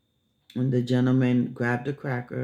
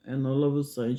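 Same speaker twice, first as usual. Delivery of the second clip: ordinary talk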